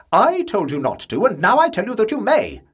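high, loud impatient retort. A narrator's reading. Aunt in the tank, answering the boy impatiently. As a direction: snap it back, losing patience. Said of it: "rather impatiently".

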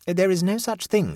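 high-pitched, prim denial; conscious defiance. A narrator's reading. Neighbour denies that the illness exists, consciously defiant. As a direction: state it with deliberate defiance. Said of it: "with the conscious air of defiance".

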